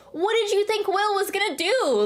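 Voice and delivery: exasperated, pitch shooting up; incredulous. Real, not acted. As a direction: argue it in high-pitched disbelief. Arguing against the flinch claim.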